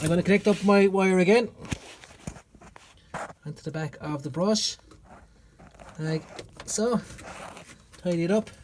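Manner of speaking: ordinary talk, nothing marked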